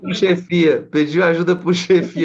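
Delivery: loud, high call to the crowd. Real, not acted. Pastor calling out to the church, loud and high. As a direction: shout it out over her story.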